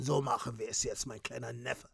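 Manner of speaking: low, quiet menacing voice; menacing boss. Acted. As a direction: threaten calmly from deep down.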